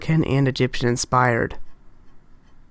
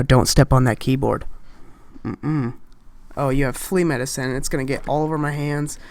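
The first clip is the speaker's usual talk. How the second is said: ordinary talk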